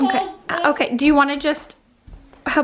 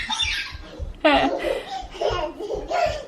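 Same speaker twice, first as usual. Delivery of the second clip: high laugh; 8 semitones higher, 5 dB quieter than usual. Real, not acted. Laughs at toddler jumping.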